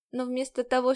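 usual speaking voice

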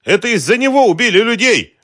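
high, loud, frantic outburst; shouted accusation. A narrator's reading. Woman struggling free to accuse him, high frantic shout. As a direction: scream the blame at him. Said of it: "кричала".

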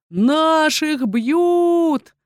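высокий, очень протяжный клич; прокричи тревожный клич, растягивая слова; выкрик из толпы. A narrator's reading. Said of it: "выкрикнул кто-то". (протяжный крик из толпы)

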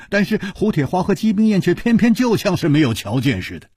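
usual speaking voice

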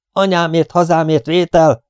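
high, urgent call sign; shouted radio call. A narrator's reading. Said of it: "ordította Danny újra".

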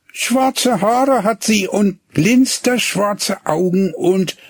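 put-on high, slow, faltering boy's voice; dreamy, then breaking off; hesitant boy. A narrator's reading. Boy describes his sweetheart, then falters. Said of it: "er stockte".

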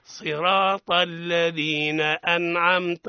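slow, chanted tajweed reading; measured melodic recitation; Quran recitation. Acted. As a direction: recite slowly for pupils to repeat. Teacher chants the verse.

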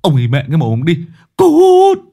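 furious shriek building to a scream; pained howl telling someone off. Acted. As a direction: snap fast, then scream the last word high and loud. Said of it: "Kiên rú lên".

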